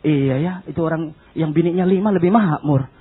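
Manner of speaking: lightly acted doubt; doubting believer.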